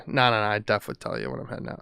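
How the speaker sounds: normal talking voice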